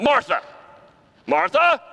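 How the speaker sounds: high, loud, exasperated scolding. Shown as a demonstration. How Jesus did not say it: a high loud scolding. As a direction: snap the name like a scolding. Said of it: "It wasn't".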